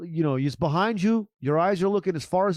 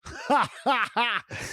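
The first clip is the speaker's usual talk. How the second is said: a higher, hammed-up villain laugh after a threat; menacing laugh demo; 8 semitones higher than usual. Shown as a demonstration. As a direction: laugh at the frozen intruder, higher-pitched. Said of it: "you could follow it up with a laugh".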